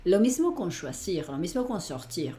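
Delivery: normal talking voice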